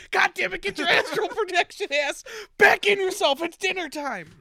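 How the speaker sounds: high, loud dinner-table yelling; furious father scolding. Retold or imitated. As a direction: tell him off like an angry dad. Emperor scolding son at dinner.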